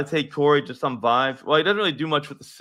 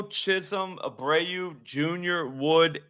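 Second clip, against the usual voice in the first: ordinary talk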